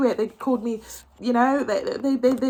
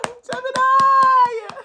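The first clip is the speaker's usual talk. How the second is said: much higher and louder, long drawn-out call; overjoyed cry; 7 dB louder, much slower than usual. Real, not acted. To the Gemini viewers, a drawn-out overwhelmed cry.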